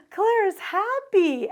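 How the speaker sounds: put-on high, loud, drawn-out little-girl cheer; gleeful mock child voice; happy toddler. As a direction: imitate a toddler suddenly turning happy. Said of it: "she changes just like that".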